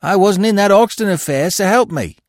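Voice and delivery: high, loud, anxious denial; fearful protest. A narrator's reading. Prisoner denies a crime to the detective, fearful. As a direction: protest innocence in alarm. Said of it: "something like fear shaded his face".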